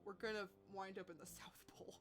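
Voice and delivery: low, whispery worried mutter; unsure, nearly voiceless aside; shaky and unsure. Acted. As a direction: mutter it doubtfully, losing voice. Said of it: "Her voice sounds incredibly shaky and unsure".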